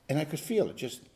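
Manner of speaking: normal talking voice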